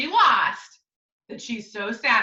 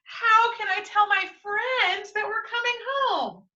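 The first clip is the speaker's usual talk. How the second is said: put-on upset girl; 9 semitones higher than usual. Girl upset about lost tablet.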